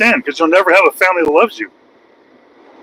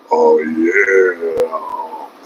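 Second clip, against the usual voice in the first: deep drawn-out hype; 3 dB quieter than usual. Acted.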